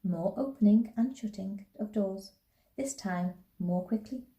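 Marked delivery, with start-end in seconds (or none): none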